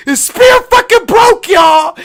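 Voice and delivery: high, slow, loud disbelief; shocked shout. Real, not acted. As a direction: yell it like you still can't believe it. Reliving the spear breaking.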